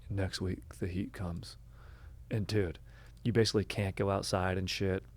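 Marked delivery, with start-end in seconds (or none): 0.1-1.6 softer, resigned warning; mock doom, quiet and resigned; defeated tone. Waiter warns about the coming heat, defeated and soft. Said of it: "he just had this defeated tone".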